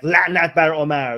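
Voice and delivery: loud mock curse, declaimed; hammed-up public cursing; mock cursing shout. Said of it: "You shouldn't go out and be like".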